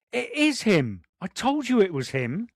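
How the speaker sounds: high-pitched thrilled recognition; retold excited onlookers. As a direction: imitate fans spotting someone famous. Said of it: "I could hear".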